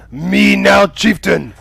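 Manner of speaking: a proud shout for the new chief; little yell. Acted. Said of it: "he just kinda gives a little yell".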